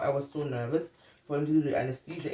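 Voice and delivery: ordinary talk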